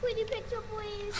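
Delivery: put-on high squeaky baby talk. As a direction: whine it like a small child. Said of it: "doing the baby voice".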